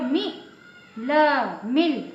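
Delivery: मी as short mi, then ल, then मिल. Shown as a demonstration. Teacher building the small-matra word, short vowel. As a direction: spell out मिल with a brief i. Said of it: "छोटी की मात्रा लगी".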